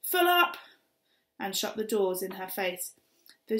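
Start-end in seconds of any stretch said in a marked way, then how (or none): 0.0-0.6 loud, high bark of a bus driver; cross driver shouting at Kat; driver shouts. Acted. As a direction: shout to refuse more passengers. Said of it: "shook his head and shouted".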